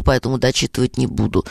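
ordinary talk, nothing marked

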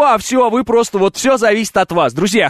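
usual speaking voice

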